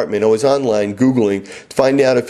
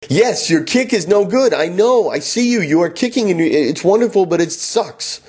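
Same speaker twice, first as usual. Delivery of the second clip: exasperated; 6 semitones higher than usual. Retold or imitated. Exasperated reply to student.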